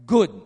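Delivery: a loud, low, weighty "good"; emphatic stress. Shown as a demonstration. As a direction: land hard on "good", pitched low. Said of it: "it's really emphasizing this word".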